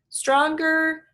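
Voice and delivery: high, loud, hearty dad; cheerful dad voice. Acted. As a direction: beam with pride. George speaking.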